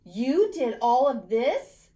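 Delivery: father can't believe his eyes; astonished father. A narrator's reading. Father staring at the changed house, loud disbelief.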